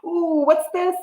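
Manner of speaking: ordinary delivery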